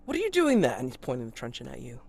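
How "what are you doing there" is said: much higher and louder demand; a policeman calling out a suspect; constable's challenge. Acted.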